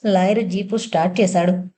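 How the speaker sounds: plain reading voice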